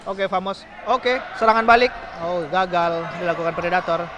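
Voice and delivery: ordinary talk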